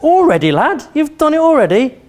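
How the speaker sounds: high-pitched, incredulous dad voice; acted astonishment. Dad to son back within a minute, mock amazement. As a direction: act out a dad's disbelief, pitch leaping up.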